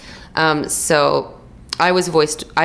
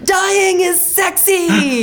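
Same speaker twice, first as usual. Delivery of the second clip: much higher and slow, drawn out; hammed-up sexy; 4 dB louder, much slower than usual. The director's quote, much higher, slow and hammed-up sexy. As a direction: purr it like a seductive director. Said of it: "But he's like".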